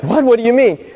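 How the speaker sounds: high, loud disbelieving what; incredulous. Retold or imitated. Caller stunned that Tony asks him to call back, high disbelief. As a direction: shoot up in pitch, baffled. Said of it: "Person's like".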